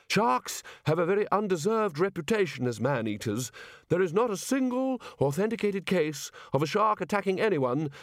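a hint of a self-assured old professor; pompous lecturing don. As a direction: lecture about sharks with easy authority. The chair's long speech.

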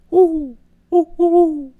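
high, loud imitated owl hooting "woo woo"; owl hoots. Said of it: "the normal hooting of the owl".

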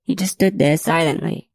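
usual speaking voice

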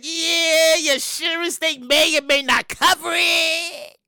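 drawn-out, level singsong impression; noncommittal receptionist. Receptionist answering host about veneer coverage, drawn-out and noncommittal. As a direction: stretch the words out as a mock receptionist.

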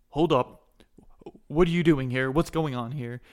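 commanding guard's shout; guard challenge. Acted. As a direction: challenge a suspect loudly. Guards challenge the player at crime scene.

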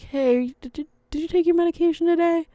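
high, quick little questioning voice; gentle check-in. Acted. Someone asking a person about missed meds, gentle check-in. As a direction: ask in a careful, high-pitched singsong.

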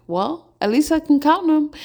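high, loud and delighted; a happy-go-lucky yogi who is thrilled to count leaves. Retold or imitated. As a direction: exclaim it with pure joy. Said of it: "and he's like".

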